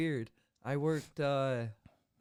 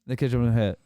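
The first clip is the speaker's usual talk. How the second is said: very loud rattled-off sentence; fast, run-on; 13 dB louder, much faster than usual. Shown as a demonstration. Fast talker showing how he usually sounds, run-on and loud. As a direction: run it all together as one long word. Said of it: "You talk fast".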